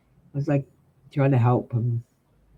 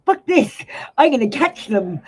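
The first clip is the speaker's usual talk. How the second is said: sudden much higher, louder burst; defiant outburst; 8 semitones higher, 4 dB louder than usual. Retold or imitated. Retelling to viewers her own defiant thought, excited outburst. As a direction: blurt it out like a dare.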